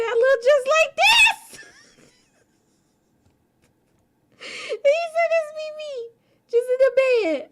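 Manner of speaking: high, loud, laughing retelling; crying laughing. Real, not acted. Streamer retelling friend's Gudetama joke, crying with laughter. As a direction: push words out through tears of laughter.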